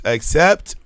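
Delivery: ordinary talk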